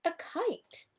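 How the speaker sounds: put-on high little child voice; baby kangaroo pointing out a kite to his mother; baby Roo. A narrator's reading. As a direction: chirp it like a tiny joey. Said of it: "said Roo".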